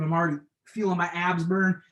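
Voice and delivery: ordinary talk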